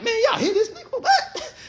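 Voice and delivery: very high, laughing mockery; mocking ridicule. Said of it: "You getting laughed at".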